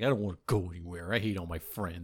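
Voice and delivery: low, fast, soft grumble; mock sullen muttering; sulky kid. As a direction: grumble it like a sulking child. Acted sulky child to mom.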